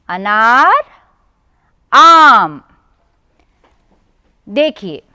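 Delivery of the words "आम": आम with the aa held long. Shown as a demonstration. Teacher repeating आम for the children. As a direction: draw out आम clearly. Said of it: "फिर से एक बार कहते हैं".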